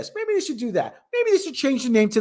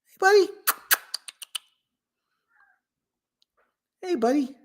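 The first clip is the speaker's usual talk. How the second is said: higher, sing-song pet voice; cat talk; 10 semitones higher than usual. Real, not acted. Owner calls his cat on camera, high pet voice. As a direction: call the cat sweetly.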